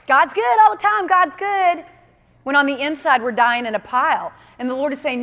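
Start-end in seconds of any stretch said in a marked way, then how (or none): 0.1-1.8 mock chirpy churchgoer, much higher and bright; forced cheer. Sermon pokes fun at Christians faking joy, chirpy mock cheer. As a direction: fake it, all sunny on top. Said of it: "And so we're like".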